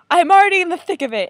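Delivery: loud, high, rushed retort; quick, high-pitched mock outburst; retold protest. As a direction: blurt it back loudly as a mock-defensive comeback. Retold retort to sister's warning.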